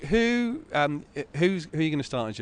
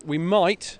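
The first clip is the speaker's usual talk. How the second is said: MIGHT hit higher and louder; stress on MIGHT; 5 semitones higher, 5 dB louder than usual. Real, not acted. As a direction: lean heavily on the word might. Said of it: "I'm stressing the word might".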